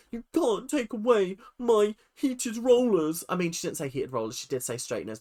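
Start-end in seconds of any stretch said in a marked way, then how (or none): 0.1-3.2 high, drawn-out disgruntled protest; mock fuming. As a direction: imitate an outraged diva complaint, slowly. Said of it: "absolutely fuming the entire time, was just so disgruntled".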